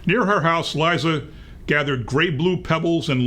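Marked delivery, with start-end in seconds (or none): none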